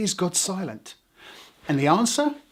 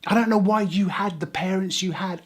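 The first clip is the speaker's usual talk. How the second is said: usual speaking voice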